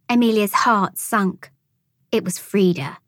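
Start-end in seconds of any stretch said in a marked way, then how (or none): none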